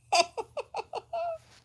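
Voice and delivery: a sudden high burst of laughter; loud high laugh. Real, not acted.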